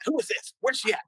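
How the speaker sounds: a lightly voiced angry New Orleans mom; mock fierce mom. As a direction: play the protective mother storming in. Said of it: "she's from New Orleans".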